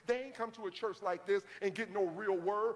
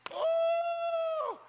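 very high, loud sustained ooh; long piercing preacher's ooh; high loud whoop; 8 dB louder than usual. Real, not acted. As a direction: whoop it out to the congregation. Preacher exclaims.

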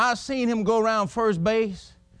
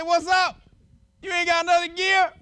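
hollered tease; 10 semitones higher than usual. Retold or imitated. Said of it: "I hollered at him".

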